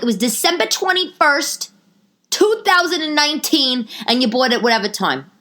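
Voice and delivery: high, emphatic date recital; acted-out indignant comeback; pointed accusation. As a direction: throw the evidence in their face. Reciting damning date to boyfriend.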